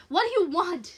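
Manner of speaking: high, loud plea; frightened demand. Acted. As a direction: cry out to the darkness. Terrified woman cries to intruder.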